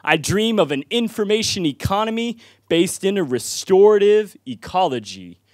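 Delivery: high, driving rhythmic verse; spoken-word poem. Real, not acted. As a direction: proclaim it to the crowd, dropping at the close of phrases. Poem builds again.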